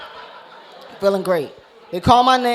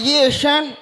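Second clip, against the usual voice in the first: put-on higher New York coach accent; 5 semitones higher than usual. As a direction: imitate a slushy-s New York rapper. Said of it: "sound like he in the Wu-Tang Clan".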